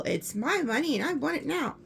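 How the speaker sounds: imitating a commercial's demanding catchphrase; commercial quote. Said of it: "What is that commercial?".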